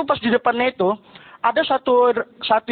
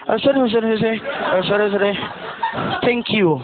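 mumbled pilot English; 3 semitones lower than usual. Retold or imitated. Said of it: "terdengar awalan sama akhirnya aja".

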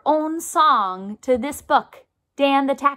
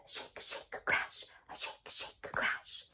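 soft whispered shake shake crash; whispered tambourine sounds; 19 dB quieter, slower than usual. Shown as a demonstration. Teacher to kids, imitating a tambourine in a whisper. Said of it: "Sounds like this".

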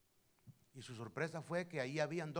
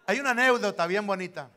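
much louder, higher burst to start the story; loud announcement; 5 semitones higher, 13 dB louder than usual. Real, not acted. Preacher loudly announcing a story to the church. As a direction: call it out to the congregation.

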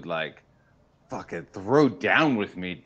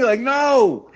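high, loud indignant shout; hammed-up protest, high and loud; outraged no; 10 dB louder than usual. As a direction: yell no in mock outrage.

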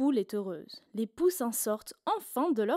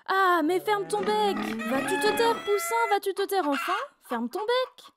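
high, loud scolding yell; shouting chorus; 8 semitones higher, 4 dB louder than usual. A narrator's reading. Farmyard and pig yelling at the chick, high and loud. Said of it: "se mettent à crier".

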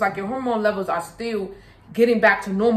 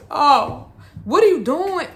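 high and louder, exasperated alarm; alarmed exclaim; 5 semitones higher, 4 dB louder, slower than usual. Real, not acted. Mother alarmed at her toddler, high and louder. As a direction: call out in alarm.

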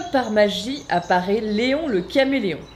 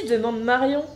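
ordinary delivery

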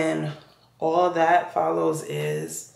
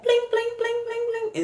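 high, rapid-fire bling sounds; phone notification chimes. Retold or imitated. Storyteller mimicking her phone flooded with messages, high chirpy chimes. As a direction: imitate a pinging phone, much higher.

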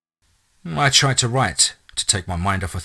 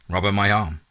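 usual speaking voice

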